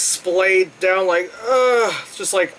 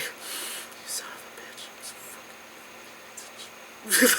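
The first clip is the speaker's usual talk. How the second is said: whispered curse; 15 dB quieter than usual. Retold or imitated. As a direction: hiss it under your breath. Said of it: "I was just like".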